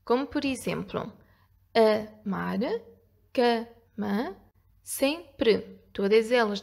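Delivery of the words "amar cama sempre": syllable by syllable. Shown as a demonstration. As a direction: split into two separate syllables. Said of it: "As palavras dissílabas têm duas sílabas".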